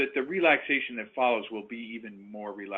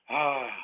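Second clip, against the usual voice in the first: audible sigh; 4 dB louder than usual. Shown as a demonstration. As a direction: demonstrate an audible sighing ah on the exhale. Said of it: "exhale that breath with an audible sigh".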